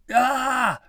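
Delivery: high, loud yell of fright; long, high startled holler. A narrator's reading. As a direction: cry out in sudden alarm. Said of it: "Pim hollered".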